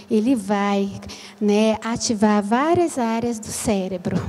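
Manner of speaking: low, subdued lecture delivery. Shown as a demonstration. Very kinesthetic teacher addressing a mixed audience, low and sluggish. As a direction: speak softly and low, without any lift. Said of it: "eu sou muito sinestésica".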